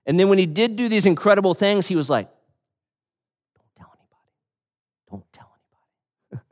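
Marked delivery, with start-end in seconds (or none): 3.6-6.5 secretive hushed whisper; Jesus whispering to keep it quiet. Retold or imitated. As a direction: breathe it like a secret. Jesus voiced asking for secrecy.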